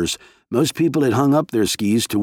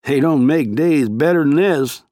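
slow, easy Western twang; relaxed cowboy drawl, higher; Western accent; 6 semitones higher, 3 dB louder than usual. A narrator's reading. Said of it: "in the Western accent he had cultivated".

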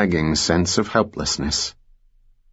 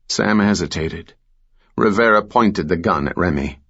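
usual speaking voice

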